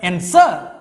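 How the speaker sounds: misplace the stress onto the end of answer; exaggerated late stress on answer; wrong syllable stressed. Shown as a demonstration. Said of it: "you cannot pronounce".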